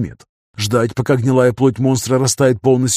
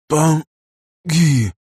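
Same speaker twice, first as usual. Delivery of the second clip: weak gurgle, a touch higher; gurgling dying voice; 4 semitones higher than usual. A narrator's reading. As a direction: barely get the words out, choking. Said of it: "едва слышно пробулькал ангел". (еле слышно, булькая)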